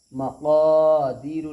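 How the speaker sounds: loud, slow मक़ादीरु with long held क़ा; madd recitation. Shown as a demonstration. Teacher giving the final reading, sustained recitation. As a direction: stretch क़ा in मक़ादीरु, end on short रु. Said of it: "खींच करके अदा करेंगे".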